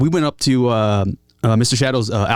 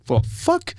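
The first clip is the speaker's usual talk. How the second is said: stunned curse. Retold or imitated. Rapper reacts in disbelief to meeting the talk box player, high stunned curse. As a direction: let the shock slip out. Cocaine stunned, throwing off shades.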